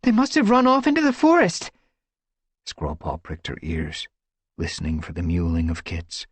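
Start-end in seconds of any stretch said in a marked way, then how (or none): 0.0-1.7 high, breathless voice; panting. A narrator's reading. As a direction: pant it out. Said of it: "Squirrelpaw panted".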